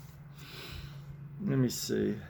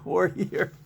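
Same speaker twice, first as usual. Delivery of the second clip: mom's line again; 5 semitones higher than usual. Pretend. Mom line repeated.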